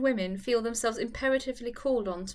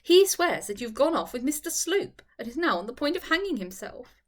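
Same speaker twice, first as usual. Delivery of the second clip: lively Charlotte; 4 dB louder than usual. Acted. Charlotte teasing her upset friend, laughing lightly. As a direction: toss it off as a joke.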